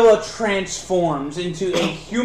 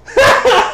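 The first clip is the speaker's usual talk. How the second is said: hysterical laughter; 6 dB louder than usual. Acted. Cheetor keeps laughing.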